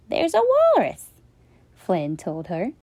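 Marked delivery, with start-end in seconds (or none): 0.1-1.1 put-on high child voice. Child insisting to Mom.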